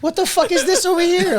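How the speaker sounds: high, shocked cry of disbelief; shocked exclamation. Retold or imitated. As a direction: react as if you can't believe your eyes. Shocked at homeless guy scene.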